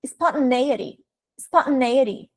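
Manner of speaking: "Spontaneity" as spon-tuh-NAY-ity; podcast speaker's pronunciation. Retold or imitated. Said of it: "ele fala assim".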